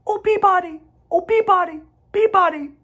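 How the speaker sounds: high, sung-out bird call; chirpy high whistle of a sparrow. A narrator's reading. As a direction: sing it like a sparrow calling across the woods.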